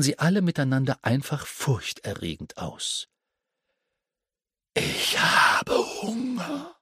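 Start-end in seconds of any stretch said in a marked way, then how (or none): 4.7-6.8 high, slow, eerie spider voice; much higher, drawn-out hungry spider. Acted. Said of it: "verkündete die Spinne".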